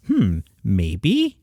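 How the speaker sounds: drawn-out hmm, tentative maybe; pondering. Retold or imitated. Host reconsidering his first reaction, doubtfully pondering. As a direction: think it over aloud, unsure. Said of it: "I thought about it and I went".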